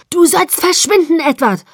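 high, loud furious shout; angry outburst at full voice; yelling at someone. A narrator's reading. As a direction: roar it to drive them away. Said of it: "brüllte ich ihn an".

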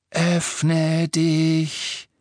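high, hissed command like a snake; hissing Parseltongue. A narrator's reading. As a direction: hiss it out like a serpent. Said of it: "wie ein Zischen und ein Fauchen". (zischend und fauchend wie eine Schlange)

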